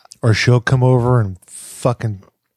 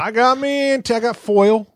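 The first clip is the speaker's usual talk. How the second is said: hillbilly voice; 11 semitones higher than usual. Pretend. Playing a hick character.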